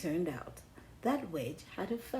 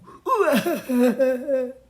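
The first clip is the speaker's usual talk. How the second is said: amused laugh; 3 semitones higher, 12 dB louder than usual. Real, not acted. Laugh after tantrum.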